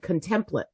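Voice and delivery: "Contemplate" as con-TEM-plit; misread pronunciation. Retold or imitated. Student reading off paper, "Contemplate" misread as con-TEM-plit. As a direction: say "Contemplate" like con plus template. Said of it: "she's not wrung because it is".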